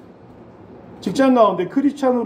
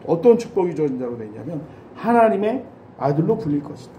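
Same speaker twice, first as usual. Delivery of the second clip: usual speaking voice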